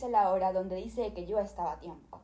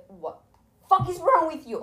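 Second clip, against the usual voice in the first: slow, higher, louder fed-up yell; pretend outburst; 7 semitones higher, 9 dB louder, slower than usual. Retort she only thought at the agent, slow yell. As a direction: let the imagined retort rip.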